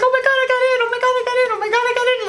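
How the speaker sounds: high, squealy mock joy, repeated; squealing contestants.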